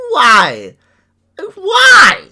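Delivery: high-pitched cry through laughter; laughing disbelief. Real, not acted. Reacting to the YouTuber going political, laughing disbelief.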